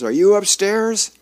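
loud, high questioning call; calling upstairs. A narrator's reading. Husband at the bottom of the staircase, calling up. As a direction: call up to someone on another floor.

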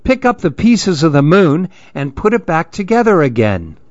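high, insistent yells at the culprit; the crowd demanding he fix it; group yelling. Acted. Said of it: "started yelling".

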